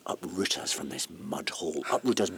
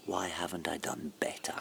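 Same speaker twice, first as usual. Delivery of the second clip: whispered, softer, to himself; despairing whisper; 4 semitones lower, 6 dB quieter than usual. Acted. Man alone asking himself in a hushed whisper. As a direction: breathe the question out in quiet despair.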